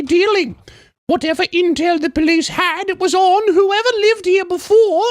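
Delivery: high falsetto English lady; English woman's accent. Acted. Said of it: "sounds like the Queen".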